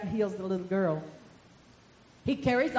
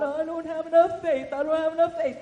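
lightly voiced anxious woman, higher; doubting woman; 6 semitones higher, 6 dB louder than usual. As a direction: fret like the unsure woman. Woman voiced doubting her faith.

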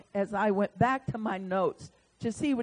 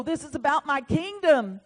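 loud, high, proclaiming the Lord's word; prophetic declaration; 7 semitones higher, 7 dB louder than usual. Retold or imitated. Preacher delivering the Lord's message, proclaiming loudly. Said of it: "And it began to flow".

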